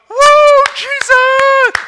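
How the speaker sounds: very high, loud, drawn-out holler; exuberant whoop. Retold or imitated. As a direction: whoop it out, fired up with joy. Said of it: "he is just walking along ... of campus going".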